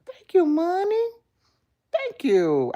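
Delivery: high, loud squeal of thanks; excited thanks. Real, not acted. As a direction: squeal it with delight.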